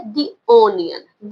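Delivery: "the" said slowly as "dee" before onion; slow, long ee in "the", then onion. Shown as a demonstration. Teacher models for pupils, slow "the" as dee. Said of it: "दी बोलोगे".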